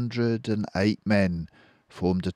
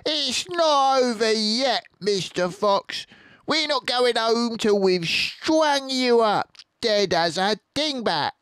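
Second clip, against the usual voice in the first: shouting down the hole; 5 dB louder than usual. Acted. Bean shouting down the hole at the fox. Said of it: "shouting down the hole".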